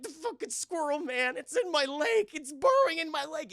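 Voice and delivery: loud, high mock-panicked wail; freaking out. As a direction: act out agonised alarm at full pitch. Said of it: "he starts freaking out".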